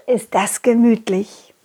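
usual speaking voice

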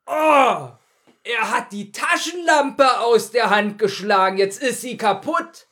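slow, hammed-up baddie line; stagey villain announcing the obvious; villain quote. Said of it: "dann sagt der Böse".

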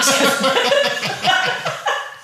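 shrill burst of laughter; high squealing laugh. Real, not acted.

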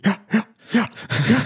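words spoken while breathing in; inhaled speech. Pretend. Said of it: "die redet auch immer so beim Einatmen". (beim Einatmen gesprochen)

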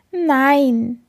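high, lightly sobbing little bird; mild sob. A narrator's reading. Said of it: "schluchzt der kleine Vogel". (leicht schluchzend, hohe Vogelstimme)

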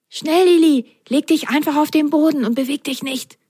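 high, voiced, urgent character voice; urgent mock whisper. A narrator's reading. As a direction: urge a friend to hide, high-pitched. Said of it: "flüsterte Ron".

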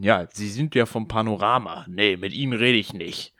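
put-on gruff dismissive old politician; Helmut Kohl. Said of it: "jetzt kurz in den Kohl-Modus kommen".

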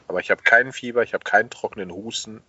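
ordinary delivery

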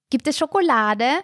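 put-on high, eager child asking „Schokolade?“ with a rise; sing-song nagging little brother; pestering little boy. A narrator's reading. Little brother pesters his sister at the ice counter, high and eager. Lasse asks again, pestering.

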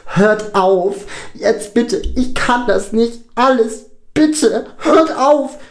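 very high, loud pleading; desperate scream. A narrator's reading. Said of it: "Lukas schreit". (verzweifelt schreien)

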